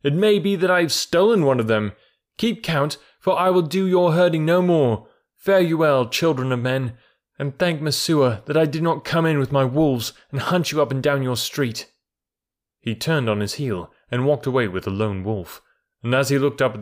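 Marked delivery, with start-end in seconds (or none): 0.0-11.9 raised, high contemptuous farewell; scornful shouting. A narrator's reading. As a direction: throw it back at the villagers with scorn. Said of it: "shouted Mowgli scornfully".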